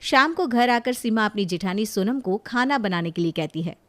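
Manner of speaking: ordinary delivery, nothing marked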